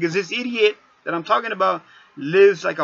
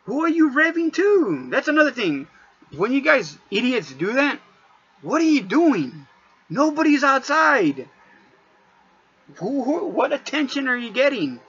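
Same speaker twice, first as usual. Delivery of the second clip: raised, incredulous voice pitched high; exasperated high-pitched rant at show-offs; 7 semitones higher than usual. Real, not acted. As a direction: call out the idiots, high and fed up. Ranting at revving drivers.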